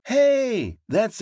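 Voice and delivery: excited hey. Acted. Narrator cheerfully agrees.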